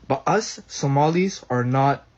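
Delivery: ordinary talk, nothing marked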